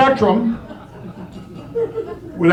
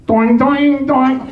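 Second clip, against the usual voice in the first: hold music; 6 semitones higher, 4 dB louder, much slower than usual. Retold or imitated.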